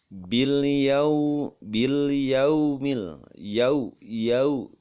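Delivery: "yau" stretched, closing on u; drawn-out correct glide. Shown as a demonstration. Teacher models the third example, long "yau" ending on u. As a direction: hold "yau" long and round into u. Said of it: "Tuh, nggak boleh yau".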